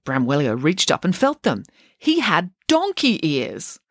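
ordinary delivery, nothing marked

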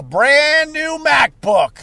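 BRAND stretched long and high; slow, high-pitched showing off; proud excitement. Real, not acted. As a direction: draw out BRAND with relish. Showing off his new purchase.

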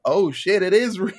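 loud mock shock breaking into a laugh; amused mock panic. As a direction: laugh through the realization. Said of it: "everybody's like".

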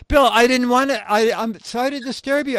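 high, loud, flustered apology; nervous apology. Retold or imitated. Young writer apologizing to the feared publisher, nervous high apology.

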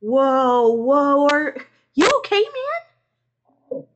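high, loud concern; startled roommate. A narrator's reading. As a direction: call out as someone barges in.

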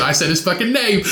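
ordinary talk

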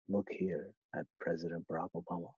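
soft, hushed video narrator; calm soothing voice. Retold or imitated. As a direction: hush it like a late-night YouTube narrator. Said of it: "It's a calm, soothing voice".

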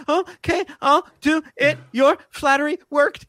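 high, hiccuping mock sobs; imitated tearful agreement. Crying kid gives in to the director, high hitching voice. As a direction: agree word by word through fake sobs.

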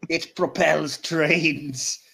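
very slow, every word stretched out; drawn-out theatrical delivery. Acted. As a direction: draw it out grandly, breaking into a laugh.

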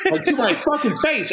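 high wheezing laugh through the words; laughing hard. Real, not acted. Friend recalling dildo to the face, laughing high. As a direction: crack up while telling it.

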